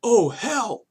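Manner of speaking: high, hammed-up yell of oh hell; mock cry of "Oh, hell.". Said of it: "I did not yell".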